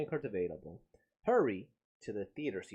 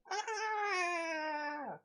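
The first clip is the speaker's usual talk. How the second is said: high screeching kaiju cry; monster screech. Retold or imitated. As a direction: let out a monster shriek. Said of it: "sound like people screeching".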